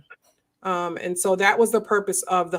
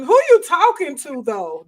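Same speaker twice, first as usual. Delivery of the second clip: high, loud street challenge; confrontational challenge; 6 semitones higher, 7 dB louder than usual. Retold or imitated. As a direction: demand to know who they're talking to. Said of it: "That's usually, um, the next".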